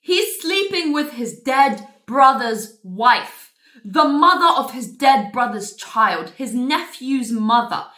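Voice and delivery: loud, slow, heavily punched words; emphatic disbelief, dragged out; scandalised. Real, not acted. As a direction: spell out the outrage word by word. Scandalised reveal of the affair.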